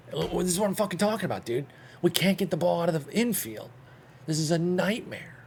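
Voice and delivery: high-pitched exasperated complaining; frustrated rant. Real, not acted. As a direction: vent at the useless offense. Frustrated gamer, team can't hit.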